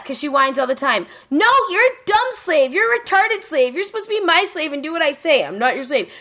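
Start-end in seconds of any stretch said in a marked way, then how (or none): 1.3-6.1 high, shrill-pitched bratty scolding; whiny bratty girl. Retold or imitated. Friend acting out the whining heroine, high bratty scold. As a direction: whine like a spoiled anime brat.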